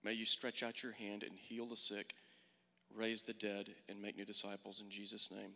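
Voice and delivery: measured prayer with long pauses; earnest prayer. Real, not acted. Speaker praying to Father for new disciples, earnest prayer. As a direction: pray aloud for the group, unhurried and subdued.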